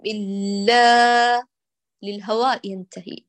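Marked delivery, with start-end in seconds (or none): 0.1-1.5 إلا slow with the lam through the nose; nasal lam. Shown as a demonstration. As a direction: draw out إلا with a nasal hum. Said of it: "لا توجهي للخيشوم".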